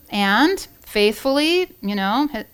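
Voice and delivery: ordinary delivery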